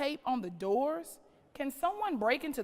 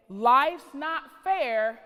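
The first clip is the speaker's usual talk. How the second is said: much louder, slow, flat-out declaration; mom's blunt reply; 2 semitones higher, 9 dB louder, much slower than usual. Retold or imitated. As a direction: lay it down hard like a fed-up mother. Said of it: "but she said".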